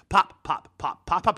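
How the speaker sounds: loud chanted pop pop, like a jingle; mock jingle chant. Imitating the Wolf Pop ad sting for his co-host, chanted loud. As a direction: bark out the ad jingle. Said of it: "everybody's different voice".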